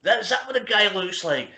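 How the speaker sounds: high, mocking quoted question; mock viewer jibe. Viewers jeering at the guest's face, high mock disbelief. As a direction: mimic a stranger sneering at the screen.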